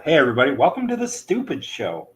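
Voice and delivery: loud, upbeat host intro; show intro announcer. Acted.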